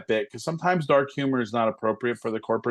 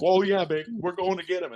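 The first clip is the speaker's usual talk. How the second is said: eager rookie cop; 6 semitones higher than usual. Retold or imitated. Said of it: "They're like".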